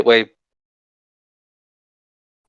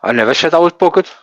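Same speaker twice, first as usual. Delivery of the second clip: parroting him, higher, louder and faster; mocking echo; 5 semitones higher, 5 dB louder, faster than usual. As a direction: throw his own words back at him in a mocking sing-song. Echoing opponent's line to mock.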